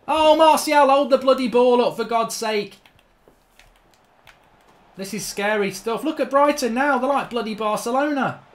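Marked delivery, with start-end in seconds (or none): 0.1-2.8 high, loud scolding of a player; exasperated shout. Real, not acted. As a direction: plead angrily from the sidelines. Scolding a player mid-match.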